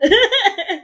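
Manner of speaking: loud embarrassed laugh; a burst of guilty laughter; awkward laugh. Real, not acted. Laughs after feeling bad.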